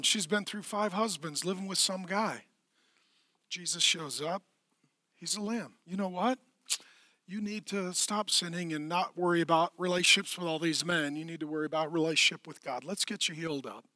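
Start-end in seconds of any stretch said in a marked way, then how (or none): 5.9-13.8 soft, low, kindly counsel; quiet, tender reassurance. Acted. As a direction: speak gently, as to someone hurting. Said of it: "He's very tender and kind toward her".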